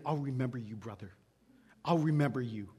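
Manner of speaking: put-on low, quiet drunken threat; a drunk growling a grudge; menacing drunk. Drunk man threatening the pastor, low and quiet.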